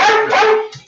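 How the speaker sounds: sudden loud high What; loud high call. Real, not acted. Owner calls out to begging dog, loud high. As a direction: bark out the question to the dog.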